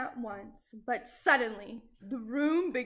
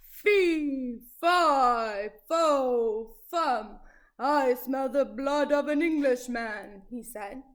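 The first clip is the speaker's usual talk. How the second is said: roaring giant; 7 dB louder than usual. Acted. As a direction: roar it like a hungry giant. Giant roars his rhyme.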